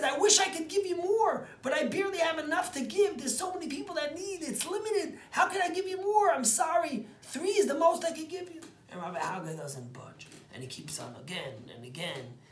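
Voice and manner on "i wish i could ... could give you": high, loud, pleading apology; old rebbe begging forgiveness, much higher and louder; pitying rebbe. Acted. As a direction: plead your sorry, hands empty. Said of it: "looks at him with pity and says".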